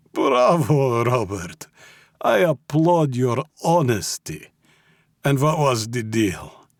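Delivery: put-on high, slow praise in Russian accent; applauding Russian mob boss. A narrator's reading. Mob boss praises captive's confession, mock approval. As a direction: applaud him in a Russian accent, then probe.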